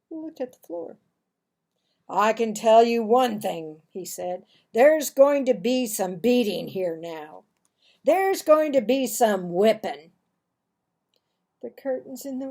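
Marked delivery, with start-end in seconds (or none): 2.1-3.8 louder, ominous father; stern father. Acted. Father threatens punishment. 4.7-10.1 stern father. Acted. Father threatens punishment.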